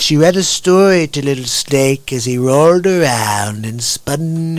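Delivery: slow mock poem recital; Donald Trump. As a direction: stretch each rhyme like a rally storyteller. Improvises rhymes as Trump.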